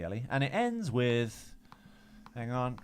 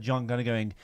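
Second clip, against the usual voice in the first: ordinary talk, nothing marked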